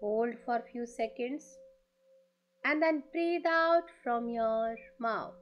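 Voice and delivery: very slow, drawn-out meditation voice; guided meditation. Acted. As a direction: lead the breathing slowly, stretching the words. Leads a breathing meditation. (धीमी, खिंची हुई ध्यान वाली आवाज़)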